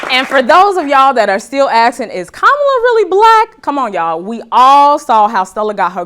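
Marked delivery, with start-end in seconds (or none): 2.1-3.6 mock doubter. Mocking doubters' question.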